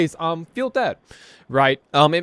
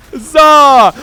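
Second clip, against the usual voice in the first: triumphant cry; 8 semitones higher, 12 dB louder than usual. Pretend. Repeats cheer louder, higher.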